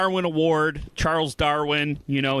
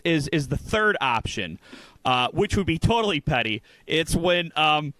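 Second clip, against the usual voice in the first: normal talking voice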